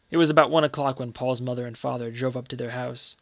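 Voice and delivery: plain reading voice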